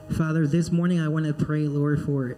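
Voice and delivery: normal talking voice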